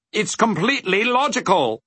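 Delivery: high-pitched, matter-of-fact figure; explaining dodecahedron. A narrator's reading. Dodecahedron explaining hunger to Milo, assured. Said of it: "explained the dodecahedron".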